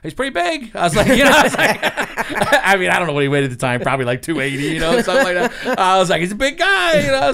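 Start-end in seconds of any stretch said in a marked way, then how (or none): 0.0-1.5 laughing. Real, not acted. Laughing retelling to officials. 4.6-7.3 laughing. Real, not acted. Laughing retelling.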